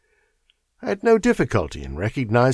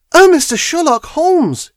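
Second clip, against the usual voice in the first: much higher and louder, bursting in; excited cry; 8 dB louder than usual. Acted. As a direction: call out to him in relief. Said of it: "she cried".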